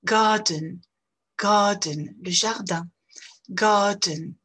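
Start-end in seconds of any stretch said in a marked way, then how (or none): 0.0-2.2 "Garden" as long A, no R sound; silent R. Shown as a demonstration. Teacher drilling learners on silent R. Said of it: "on ne prononce pas le re". 3.5-4.3 "Garden" as long A, no R sound; silent R. Shown as a demonstration. Teacher drilling learners on silent R. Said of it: "on ne prononce pas le re".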